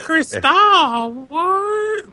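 mock shocked girl. Mocking the girl's reaction.

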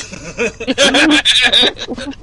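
big burst of laughter; loud laugh. Real, not acted. Reacts to story.

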